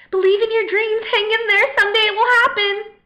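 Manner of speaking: high, loud cheerleading parody; mock encouraging friends. Friends urging her to hang on, mock peppy. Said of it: "That's what my friends keep telling me".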